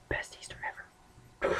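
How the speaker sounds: breathy hushed whisper, barely voiced. Real, not acted. Repeating it to himself in a hushed whisper. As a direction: whisper the aside to yourself. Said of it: "Best Easter (said plainly first)".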